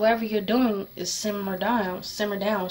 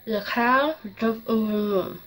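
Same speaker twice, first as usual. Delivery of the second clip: tongue piercing slur. Shown as a demonstration. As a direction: speak around a swollen pierced tongue. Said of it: "think that weird slur".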